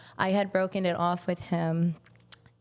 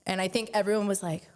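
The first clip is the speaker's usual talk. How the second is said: normal talking voice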